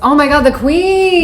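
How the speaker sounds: loud, slow excited exclamation. Real, not acted. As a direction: burst out with delight. Excited outburst over the queens.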